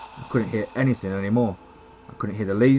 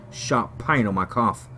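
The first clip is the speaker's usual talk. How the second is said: ordinary delivery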